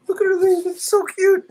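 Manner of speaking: real adoring coo. Real, not acted. Dad watching his toddler, adoring high coo. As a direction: coo over something unbearably cute.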